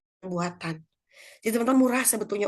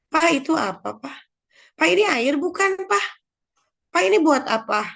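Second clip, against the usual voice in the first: put-on high, loud pestering kid; curious child; 8 semitones higher, 5 dB louder than usual. Child asking father busy fixing the motorbike, curious. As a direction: imitate a child badgering Dad. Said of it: "gitu ya ke bapaknya".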